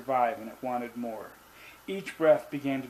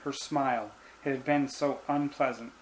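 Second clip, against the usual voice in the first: ordinary delivery, nothing marked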